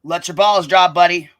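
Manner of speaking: loud mocking jab at a rival; loud taunt. Real, not acted. Host taunting the rival about his voice, loud and mocking. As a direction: shout it down at him like a put-down.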